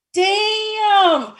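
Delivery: long, high drawn-out "damn"; loud, stretched-out exclamation of amazement; amazed. Retold or imitated. Daughter seeing mother after months, amazed. Reacting to mother's changed looks.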